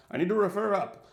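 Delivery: hammed-up British accent, stressing "refer"; lame British accent. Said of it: "This is my lame British accent".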